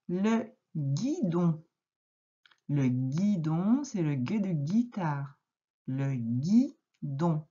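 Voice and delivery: very slow and deliberate; slow dictation. Shown as a demonstration. Teacher dictating to a pupil, very slowly. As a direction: stretch every syllable for spelling.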